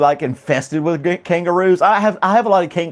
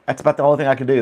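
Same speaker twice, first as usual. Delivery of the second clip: usual speaking voice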